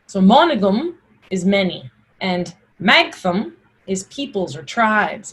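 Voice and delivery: normal talking voice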